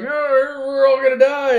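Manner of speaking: high, loud doom-laden wail; mock-panicked robot crying out that all is lost; C-3PO panicking. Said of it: "C three P O is like".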